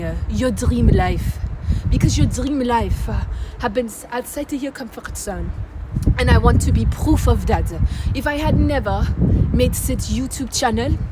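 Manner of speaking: hammed-up French accent, halting delivery; put-on French accent. Multi-talented vlogger showing off to viewers, mock French accent. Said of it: "I could do a French accent".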